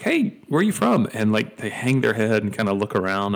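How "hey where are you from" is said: high-pitched, bright, friendly question; cheery high greeting. Retold or imitated. Churchgoer greeting a newcomer, high and cheery. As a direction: sing it up high like an eager greeter. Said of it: "I'll ask 'em, like".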